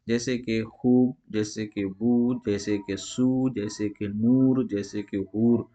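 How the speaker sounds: खूब, बू, सू, नूर, हूर with the ऊ stretched slowly; teacher drawing out oo; long u. Shown as a demonstration. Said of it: "ये वाव खींच कर पढ़ी जाती है".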